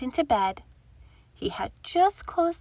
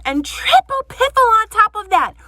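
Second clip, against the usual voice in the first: angry shout, higher and much louder; 10 semitones higher, 9 dB louder than usual. Acted. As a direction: bellow it at the door. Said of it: "shouted Grumpy Badger".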